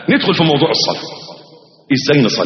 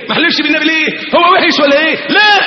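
high raised shout, protesting then answering; shouted mock argument; 9 semitones higher than usual.

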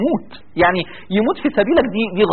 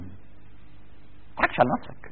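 very slow whispered warning, much softer; hushed warning; 8 semitones lower, 13 dB quieter, much slower than usual. Real, not acted. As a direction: breathe it slowly as a grave warning.

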